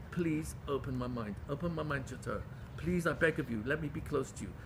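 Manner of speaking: low, hushed pleading prayer; begging softly. Acted. Showing how one begs God, soft humble pleading. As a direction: plead quietly, voice dropped. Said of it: "so that you can beg him".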